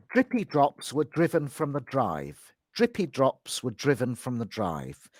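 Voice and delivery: "Drippy drops were driven from the drive" with crisp dr; clear dr sound. Shown as a demonstration. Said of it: "Let's just do a sentence with the D R sound".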